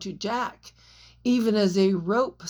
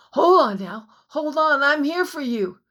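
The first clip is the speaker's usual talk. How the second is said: rising, louder desperate reassurance; desperate pleading; 5 semitones higher, 6 dB louder than usual. A narrator's reading. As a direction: beg her to hold on. Jack pleading to Anne.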